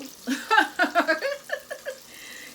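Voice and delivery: high, loud burst of laughter; real laugh at the cheap fryer; big laugh. Real, not acted.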